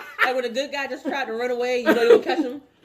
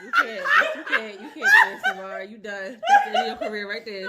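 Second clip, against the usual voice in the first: laughing hard. Real, not acted. Laughing hard at failed ASMR.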